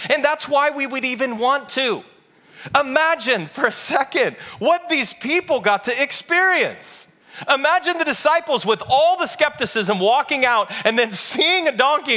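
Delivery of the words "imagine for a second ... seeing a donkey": raised, driving preacher's voice; impassioned preaching. Acted. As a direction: preach it with building fervor. Preaches at full force.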